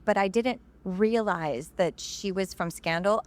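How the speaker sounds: ordinary talk, nothing marked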